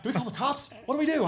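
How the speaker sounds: mock panic. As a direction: pitch it way up, fretting what to do. Voicing alarmed companion during gun standoff.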